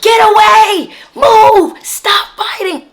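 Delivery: high, loud barked police orders; retelling cops yelling at the fighters; imitating screaming cops. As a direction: shout the commands like a cop breaking up a fight. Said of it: "The cops start screaming".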